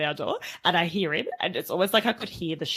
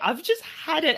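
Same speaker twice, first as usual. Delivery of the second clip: high-pitched mock exasperation; retold exasperation; 9 semitones higher than usual. Mum at the end of her patience, retold exasperation.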